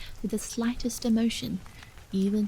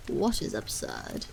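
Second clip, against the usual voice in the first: dull; 14 semitones lower than usual. A narrator's reading. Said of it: "he asked, dully".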